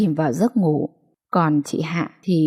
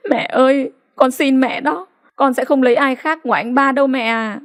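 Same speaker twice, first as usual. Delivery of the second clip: higher, tearful begging; crying plea; 6 semitones higher than usual. A narrator's reading. Daughter begs her mother to let her marry, crying high. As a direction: plead through sobs, pitched up. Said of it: "khóc to hơn".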